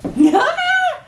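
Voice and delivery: high, laughing squeal of joy; giddy shriek of excitement; delighted squeal. Real, not acted. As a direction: let out a high happy squeal. Overjoyed at the bag.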